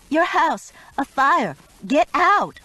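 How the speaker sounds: high, loud, urgent bursts; shouting a fire warning down the phone; panicked shouting. A narrator's reading. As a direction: yell the warning down the line. Phoning neighbors about fire.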